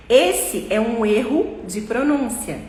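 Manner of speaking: ordinary talk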